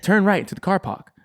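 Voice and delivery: high-pitched imitation British satnav; British accent. British Siri guiding a driver, imitated British accent. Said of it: "like, a British accent".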